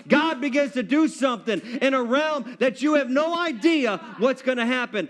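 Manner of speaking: normal talking voice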